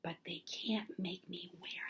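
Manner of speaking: whispered, stubborn vow; defiant whisper. A narrator's reading. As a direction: whisper it like a secret act of defiance. Child defiant secret vow.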